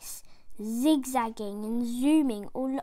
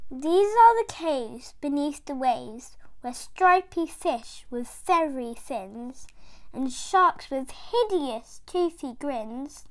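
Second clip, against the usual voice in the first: plain reading voice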